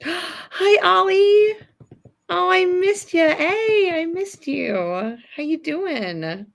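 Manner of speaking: high, delighted hello to a friend; excited greeting. Real, not acted. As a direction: squeal a happy hello.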